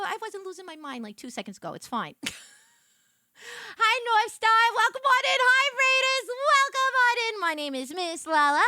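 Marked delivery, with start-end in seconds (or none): 3.8-8.6 high, loud chirpy welcome; high, loud cheery diner waitress; diner waitress greeting. Acted. Greeting raiders in waitress persona.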